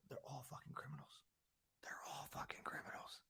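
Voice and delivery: breathy whisper, no voice at all; whispered aside. Real, not acted. Podcaster whispering to listeners about politicians, hushed. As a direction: drop to a secret whisper twice.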